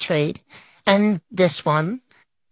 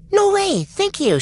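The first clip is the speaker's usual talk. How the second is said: excited squeal; 11 semitones higher than usual. Real, not acted. Player thrilled a trader accepted, squealing high. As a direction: shriek with joy at the offer.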